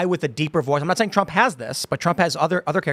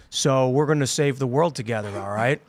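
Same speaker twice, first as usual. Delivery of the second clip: slow dim guy; 2 semitones lower than usual. Retold or imitated. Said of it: "he talked like this".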